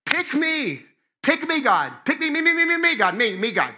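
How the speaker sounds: high, loud begging to be chosen; over-eager volunteering. Acted. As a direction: plead like an excited kid straining to be picked. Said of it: "I should be first in line".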